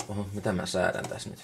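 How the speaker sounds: ordinary delivery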